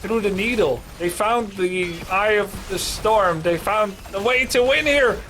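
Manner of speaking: slow, weighty victory call; dramatic caster. Acted. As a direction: savor each phrase like a finale.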